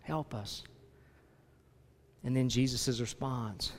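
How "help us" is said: softly beg, sinking quieter; pleading father. Acted. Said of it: "the man cries out".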